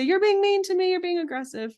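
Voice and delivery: high, loud mock-whiny protest; whiny accusing partner. Partner pushing back on a boundary, high whiny mock accusation. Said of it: "Now they might say".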